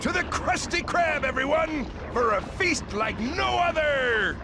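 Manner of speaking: high, jubilant call to a feast; rally the crew to celebrate; rallying cry. Acted. Boss invites everyone to feast, high and jubilant.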